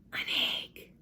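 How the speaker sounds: unvoiced whisper. A narrator's reading. Sheldon whispering the secret shape to Lucille. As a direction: breathe it as a secret. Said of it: "he whispered".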